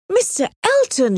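high-pitched, scandalised exclamation; startled, high protest; shocked cry. A narrator's reading. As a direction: cry out the name in shock, voice jumping up. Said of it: "she cried".

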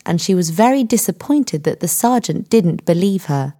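plain reading voice

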